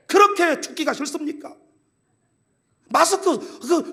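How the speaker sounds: ordinary delivery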